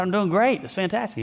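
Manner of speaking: peppy. Retold or imitated. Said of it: "had a little pep in his voice".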